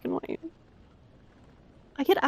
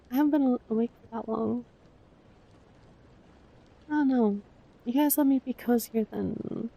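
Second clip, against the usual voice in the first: ordinary delivery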